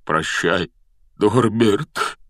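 choked-up goodbye; voice breaking. A narrator's reading. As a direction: squeeze the farewell out as the voice gives way. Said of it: "срывающимся голосом выдавил из себя Хагрид". (срывающимся от горя голосом)

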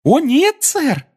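very high happy squeak; cheerful elf squeak. Acted. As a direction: squeak it out gladly. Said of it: "радостно сообщил эльф".

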